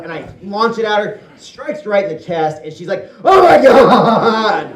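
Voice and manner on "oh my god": high, loud, drawn-out shriek; the sister shrieking when the poop hits her; disgusted shriek. Retold or imitated. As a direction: scream in disgust with a laugh.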